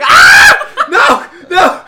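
screaming no, high and loud with laughter; panicked scream. Retold or imitated. Little kid hearing the 911 operator, panicked screams. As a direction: shriek in mock terror.

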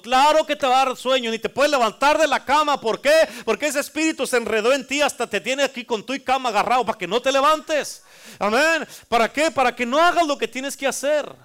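loud, high, driving sermon delivery; full-force preaching. Acted. Pastor drives the point home to the congregation, loud preaching. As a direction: preach it out to the whole church.

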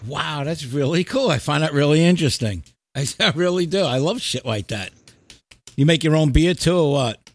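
ordinary talk, nothing marked

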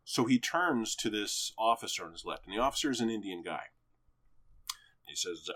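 ordinary talk

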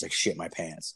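ordinary talk

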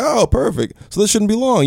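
high, chipper mock officer; cheery cop voice. Officer telling the arrested comic, high and chipper.

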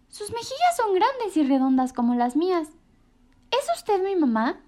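a tiny bird hailing the giraffe; little bird voice. A narrator's reading. Choco asks the walrus.

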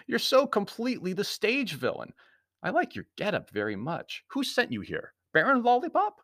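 high, teasing hero's voice; amused Anthony, mocking. A narrator's reading. Anthony teasing the stage villain, high and flippant. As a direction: needle the stage villain with rising pitch.

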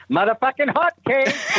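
bursting into the character voice mid-story; Teddy and Carl voice. Retold or imitated. Writer in the room slipping into the Boston character voice. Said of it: "and I would do the voice".

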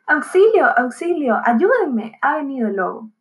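plain reading voice

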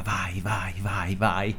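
put-on whispered urging from the girlfriend; whispering. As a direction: hush it, coaxing him to go. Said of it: "che mi diceva".

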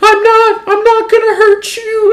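high, slow, tearful stranger's voice, far above own pitch; crying woman. Retold or imitated. Strange woman at the door pleading through tears, high and slow. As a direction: imitate a sobbing older woman pleading. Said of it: "this woman crying".

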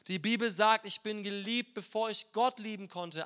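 usual speaking voice

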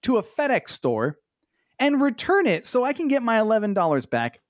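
high, mock-outraged rant; indignant. Real, not acted. Ranting about returning an eleven dollar screen, high indignant. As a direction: climb high in petty indignation.

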